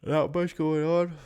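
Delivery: talking through a yawn; words stretched by a yawn. Real, not acted. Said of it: "you guys can hear me yawning".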